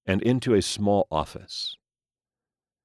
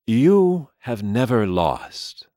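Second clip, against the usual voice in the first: aging gentleman voice; 5 semitones higher, 4 dB louder, slower than usual. Acted. Mister Lee speaks.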